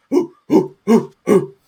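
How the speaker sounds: loud, high rhythmic hoots; whooping crowd of friends; cheering. Acted. Dwarf's friends cheering her on, loud high whoops. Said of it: "are, like, cheering".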